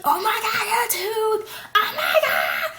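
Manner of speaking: loud, high mock shriek; over-exaggerated shock. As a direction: imitate a friend freaking out. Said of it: "she's just naturally over-exaggerant".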